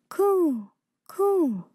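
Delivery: high, falling coos; cooing pigeons. A narrator's reading. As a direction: coo like a storybook pigeon. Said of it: "said the pigeons".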